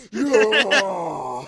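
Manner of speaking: loud hammed-up roar; mock roar. Goth girl roaring at Harry, loud mock roar. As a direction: bellow it like a brute. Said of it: "I roared".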